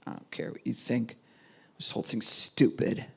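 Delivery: low, dismissive and bitter; resentful kid shutting down. Retold or imitated. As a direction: brush it all off, sulking. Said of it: "acting just bitter".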